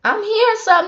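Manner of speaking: high-pitched wonder; hearing the new pastor for the first time, pitched high in wonder; amazed realization. Retold or imitated. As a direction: voice jumps up as it dawns on you. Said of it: "And I'm like".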